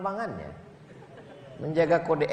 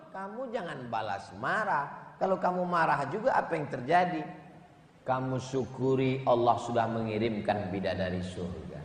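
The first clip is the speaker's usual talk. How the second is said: usual speaking voice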